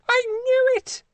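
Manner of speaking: a very high shrieked I knew it; scream. Acted. The Queen hears Cremona, shrieking triumphantly. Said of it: "The Queen screamed".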